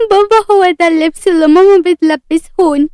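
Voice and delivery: put-on high-pitched tearful little girl; whiny, teary complaint. A narrator's reading. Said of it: "تلا بدموع".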